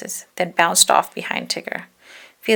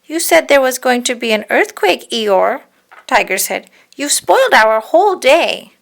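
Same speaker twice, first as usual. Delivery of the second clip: higher, louder grumbling tiger; complaining Tigger; 5 semitones higher, 7 dB louder than usual. Acted. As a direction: scold him like a let-down friend. Tigger scolds Eeyore.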